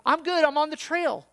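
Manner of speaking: loud, high cheery holler; oblivious calling out. Acted. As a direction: call from far off the trail. Acted lost hiker calling out.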